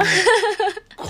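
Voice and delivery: sudden high squeal of laughter; high laughing burst. Real, not acted. Reacts to the name.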